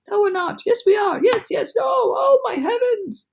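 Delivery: very high, frantic cries; flip-flop in panic. A narrator's reading. Ladybug panicking before the crash, frantic high cries.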